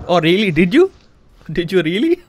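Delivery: sarcastic scolding. Acted. Mock scolding after crash.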